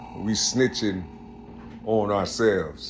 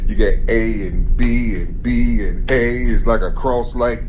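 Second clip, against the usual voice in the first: fired-up preaching; 7 dB louder than usual. Real, not acted. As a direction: preach it hard to the crowd, getting louder. Rising street preaching about God.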